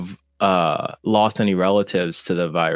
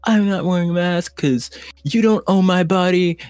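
high-pitched mock Karen rant; mock outrage, pitched way up; indignant Karen; 11 semitones higher than usual. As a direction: imitate an anti-mask Karen going off. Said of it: "like Karens or".

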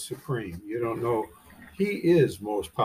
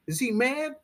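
mock-innocent question; 12 semitones higher than usual. Rhetorical question to congregation.

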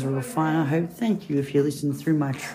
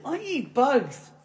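slow, high bird voice; joke bird; 7 semitones higher, much slower than usual. Acted. Bird answering the frog, slow and high. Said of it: "He goes".

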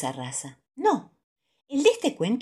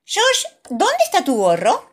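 Mummy Pig asking; 13 semitones higher, 7 dB louder than usual. A narrator's reading. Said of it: "pregunta mamá".

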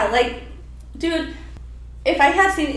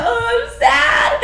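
high, drawn-out mock wail; wailing self-mockery, stretched out; mock crying; 12 semitones higher, 5 dB louder, much slower than usual. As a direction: exaggerate being sad, loud and high. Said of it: "Like, I was crying".

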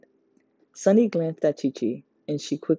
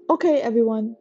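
raised, higher call to the group; announcing; 8 semitones higher, 5 dB louder than usual. A narrator's reading. Chi-Chi announcing to the group, raised and high. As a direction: call everyone to attention. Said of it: "Chi-Chi announced".

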